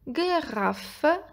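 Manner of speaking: each syllable given its own beat; teacher sounding out syllables. Shown as a demonstration. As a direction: slowly split into three syllables. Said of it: "têm três sílabas".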